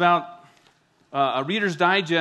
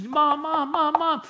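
put-on kids calling mom; 11 semitones higher, slower than usual. Kids still calling at the door, high and whiny. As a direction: keep pestering like a little kid.

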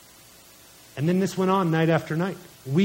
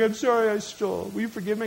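put-on apologetic little boy; 2 semitones higher, 4 dB quieter, faster than usual. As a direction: meekly ask forgiveness as a child. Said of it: "He's like".

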